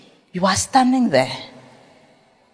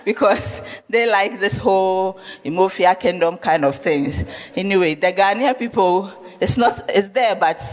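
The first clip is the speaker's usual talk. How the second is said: ordinary delivery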